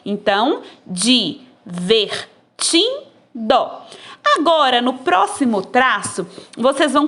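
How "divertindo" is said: divertindo stretched very slowly, syllable by syllable; syllables drawn out. Shown as a demonstration. Teacher to pupils, slow syllable-split divertindo. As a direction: pull divertindo apart one syllable at a time. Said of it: "vocês vão separar as sílabas".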